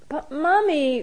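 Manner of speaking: put-on high, drawn-out little-boy whine; childish whine. A narrator's reading. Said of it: "whined Luca".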